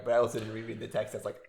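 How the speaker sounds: ordinary talk, nothing marked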